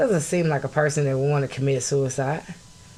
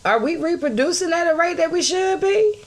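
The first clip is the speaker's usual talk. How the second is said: furious shout; 12 semitones higher than usual. Real, not acted. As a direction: yell it like a battle cry. Escalating rant about the community.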